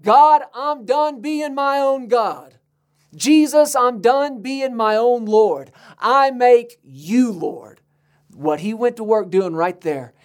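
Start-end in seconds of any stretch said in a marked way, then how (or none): 0.0-7.8 higher, slow, earnest surrender prayer; heartfelt surrender. Retold or imitated. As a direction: pray it with raised pitch and full sincerity. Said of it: "The moment you said".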